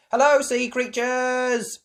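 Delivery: slow, high, loud welcome; Miss Rabbit hostess. Acted. Miss Rabbit greeting the children, slow loud high welcome. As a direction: welcome the guests like a show host.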